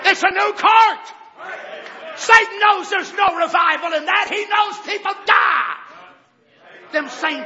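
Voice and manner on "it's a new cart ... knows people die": loud, high preaching cry; preaching shout. Real, not acted. Preacher shouting at his flock about revival. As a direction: thunder it at the congregation.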